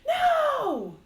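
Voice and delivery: high, loud anguished scream of no. Acted. Saren screams as the bride falls, anguished and loud. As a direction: cry out to stop her falling. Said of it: "he screams".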